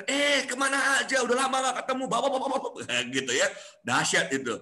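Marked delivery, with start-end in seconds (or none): 0.1-2.8 excited extrovert. Retold or imitated. Extrovert friend answering a greeting, high and gushing. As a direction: burst out like a thrilled old friend.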